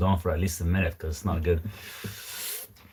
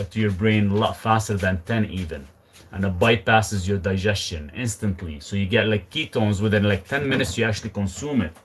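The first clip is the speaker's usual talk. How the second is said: ordinary talk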